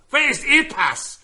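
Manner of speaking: high, loud German demand; German accent. Shown as a demonstration. Comic demanding a passport for laughs, shouted German accent. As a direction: shout like a German border guard. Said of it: "do my German accent".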